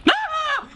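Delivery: short, very high shriek; startled scream. Real, not acted. Player startled by the game, high scream. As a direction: yelp as if jumped. Jump scare.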